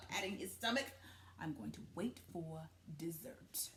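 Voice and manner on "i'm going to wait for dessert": sly villain whisper. Acted. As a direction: savor the threat quietly. Wolf's sly threat.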